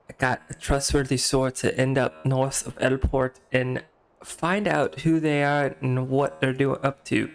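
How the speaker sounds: effortful, badly faked Scottish; brief the adventurer in a wobbly Scottish accent; poor Scottish accent. Quest giver sending a helper to find suspicious buggers, poor Scottish accent. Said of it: "very poorly Scottish accent".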